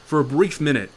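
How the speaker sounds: normal talking voice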